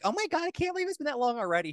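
high, over-the-top squeal of disbelief; mock gushing. Friends marking each monthly anniversary, mock gushing squeal. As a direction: gush like it's a huge milestone.

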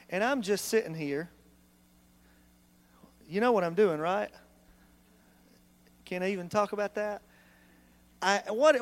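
ordinary delivery

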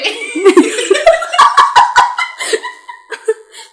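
high-pitched cracking-up laughter; long high laugh. Real, not acted. Friend cracks up at the name.

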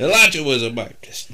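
high, loud hammed-up preacher shout; heroic rallying shout. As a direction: whip up the congregation, yelling. Mock preacher.

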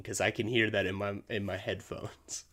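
ordinary delivery

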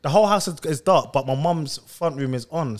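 ordinary talk, nothing marked

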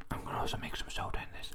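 close-mic whisper. Real, not acted. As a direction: breathe the words right into the mic. Whispering while munching popcorn.

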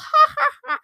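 very high gleeful giggle; a tiny gleeful squeal of a laugh; squeaky kid giggle. Retold or imitated. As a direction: giggle like a kid who just won. Kid gloating laugh after fantasy.

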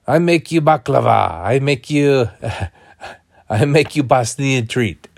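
put-on high, loud old Bosnian woman accent. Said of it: "old woman with a Bosnian accent".

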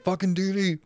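annoyed Dexter. Retold or imitated.

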